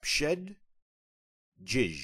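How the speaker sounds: "pshed" then "jij" as two separate syllables with a break; slow syllable-by-syllable demo; split into syllables. Shown as a demonstration. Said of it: "It's comprised of two syllables".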